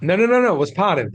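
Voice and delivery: emphatic correction. Real, not acted. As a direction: insist firmly that he got it wrong. Correcting a listener's misunderstanding.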